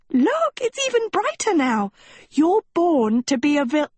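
very high, gleeful insistence, cut off; excited exclamation. Acted. Sophie excited, cut off mid-word.

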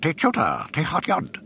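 high, alien gatekeeper voice; talking eyeball. A narrator's reading. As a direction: strange mechanical alien speech. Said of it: "The eyeball spoke".

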